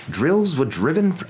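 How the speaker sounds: plain reading voice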